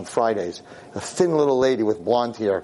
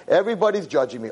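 normal talking voice

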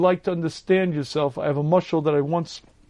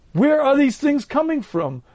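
a mock-puzzled, high-pitched exclamation; baffled outcry; 8 semitones higher than usual. Repeated with rising bewilderment.

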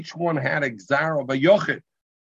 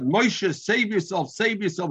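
calling out; 7 semitones higher, slower than usual. Retold or imitated. Crowd calls to drowning man.

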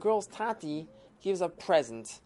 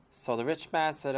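usual speaking voice

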